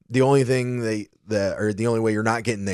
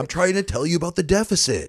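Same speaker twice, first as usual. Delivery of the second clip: put-on frustrated baby; 9 semitones higher than usual. Voicing baby with adult thoughts.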